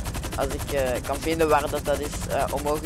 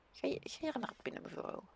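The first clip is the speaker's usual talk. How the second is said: whispering; 11 semitones higher, 15 dB quieter, faster than usual. Real, not acted. Talking to game character.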